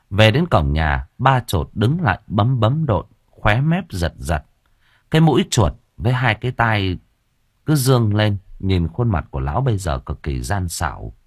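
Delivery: usual speaking voice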